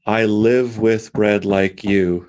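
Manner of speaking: slow, each word I live with bread like you hit as a stress; piled stresses. Shown as a demonstration. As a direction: lean hard on every syllable, very slowly. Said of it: "this wonderful way that he has of piling the stresses".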